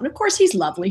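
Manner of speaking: ordinary talk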